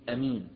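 أمين said with a short first a and no long alif; alif dropped. Shown as a demonstration. Teacher showing آمين without its long alif. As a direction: clip the opening of أمين to a plain short a. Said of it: "يحذفها بالمرة، يقول".

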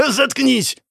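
high-pitched sudden yell; outburst at himself. Acted. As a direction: cut off the laughter with a yell. Snaps at his own laughing double.

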